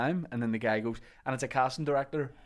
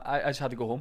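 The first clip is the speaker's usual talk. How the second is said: usual speaking voice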